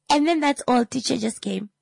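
lightly voiced daughter trailing off; high, deflating girl voice. Mother imitating daughter ending the story with nothing, high then fading. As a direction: let the big story fizzle out.

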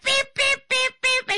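high loud beep beep beep beep; censor bleeps. Retold or imitated. Mother-in-law repeating her swear words, high loud bleeps.

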